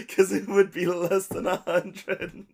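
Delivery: high laughing squeal; giggling through the justification. Real, not acted. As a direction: laugh the words out high and slow. Laughing while explaining purchase.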